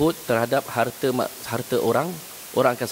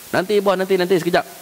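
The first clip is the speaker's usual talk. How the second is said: higher, hurried put-off to the boss; stalling worker; 6 semitones higher than usual. Retold or imitated. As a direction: brush the boss off for a moment. Angestellter vertröstet Chef.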